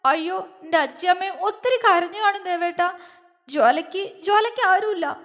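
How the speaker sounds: high, broken lament through tears; orphaned girl howling out her grief; wailing cry. A narrator's reading. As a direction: stammer and sob every phrase. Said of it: "പുലമ്പിക്കൊണ്ട് ... അലറിക്കരഞ്ഞു".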